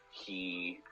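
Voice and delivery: normal talking voice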